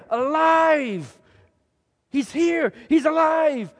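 high, loud bursts of joy; exult, pitching it up high; jubilant excitement. A narrator's reading. Preacher relives the disciples' reunion with Jesus, jubilant.

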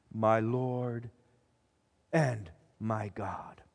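very low, hushed, slow awe; reverent confession. A narrator's reading. Thomas confesses to the risen Jesus, hushed and reverent. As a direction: drop low and soft, word by word. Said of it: "then Thomas confesses".

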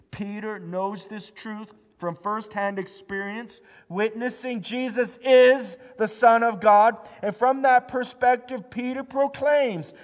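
loud, high, urgent preaching; full-force preaching. Acted. Pastor urging the church that we cannot save ourselves, preaching loud. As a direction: drive it home to the congregation.

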